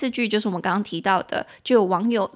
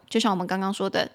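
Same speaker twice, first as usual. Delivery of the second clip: normal talking voice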